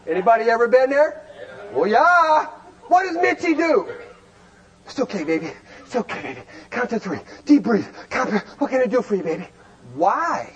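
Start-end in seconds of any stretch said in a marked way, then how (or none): 4.9-9.5 soothing. Retold or imitated. Mitchie soothing wife in labor.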